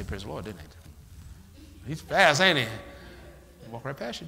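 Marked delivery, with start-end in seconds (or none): 0.0-0.6 quick muttered greeting. Retold or imitated. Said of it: "walk right past you and say".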